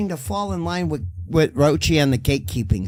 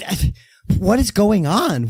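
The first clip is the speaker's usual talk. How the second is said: ordinary talk, nothing marked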